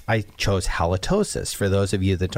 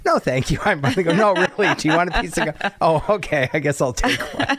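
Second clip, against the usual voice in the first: mock polite refusal; 9 semitones higher than usual. Host playfully acting out being offered gum, hammed-up. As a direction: act out a fussy little gum exchange.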